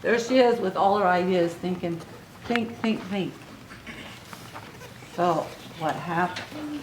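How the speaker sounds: ordinary delivery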